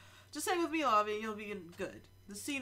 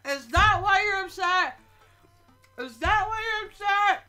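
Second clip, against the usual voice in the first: loud concerned parent; 9 semitones higher, 12 dB louder than usual. Acted.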